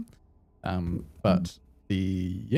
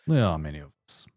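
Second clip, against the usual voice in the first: put-on Russian accent; 5 semitones lower, faster than usual. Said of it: "Russian accent for these guys".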